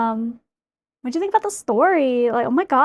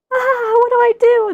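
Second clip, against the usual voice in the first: much higher, louder flustered cry; mock panic; 11 semitones higher, 7 dB louder than usual. Arya panicking the whole time, higher and louder. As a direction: play it as a helpless, panicked wail. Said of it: "poor Arya was just like".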